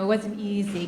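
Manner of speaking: ordinary talk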